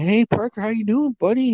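high-pitched imitation of a friendly greeting; friend's greeting. Retelling a friend greeting his nephew.

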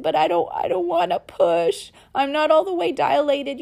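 mock whine to the Lord; whining. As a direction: whine it like a reluctant child. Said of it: "I whined at him".

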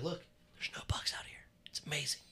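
soft whispered aside; retold whisper. Man quietly shows ex the bug-free porch, whispering. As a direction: whisper it like a secret. Retold telling ex about bugs.

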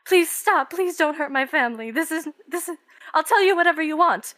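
high, pleading, rushing at the end; begging father. Acted. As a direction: beg for your family's lives. Father begging for his family.

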